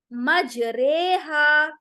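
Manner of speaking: मजरेहा drawn out very slowly, रे tilted toward e; slow, careful मजरेहा with the ri bent to re; correct recitation. Shown as a demonstration. Teacher modelling मजरेहा after rejecting मजरी. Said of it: "मजरी नहीं कहेंगे".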